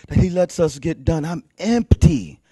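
ordinary talk, nothing marked

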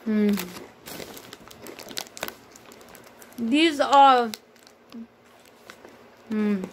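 talking while chewing; eating chips between words; mouth full, chewing. Real, not acted. Seen 0: bites snack at -7 to -4, closed-mouth chewing near hmm.

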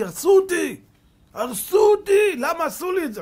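high, loud, anguished wail, slow on the repeat; crying out. Retold or imitated. Young Ethiopian student wails to the rabbi, crying shout. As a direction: howl that they ruined him, voice cracking high. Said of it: "הוא בוכה".

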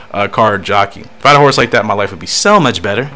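ordinary talk

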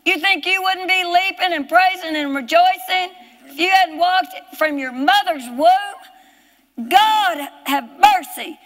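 high, impassioned pulpit cry; full-force preaching. Real, not acted. Preacher marvelling to congregation that the lame man leaped, impassioned shout. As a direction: proclaim it in long, hammered phrases.

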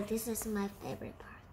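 quick, hushed whisper; whispered aside. Real, not acted. Reader confiding a favorite part, quick whisper. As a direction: whisper it fast as a secret. Aside to listeners between readings.

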